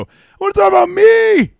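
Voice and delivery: very high, loud squeaky mock voice; hammed-up falsetto Brock parody. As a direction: squeal it out like a cartoon. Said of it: "have him go".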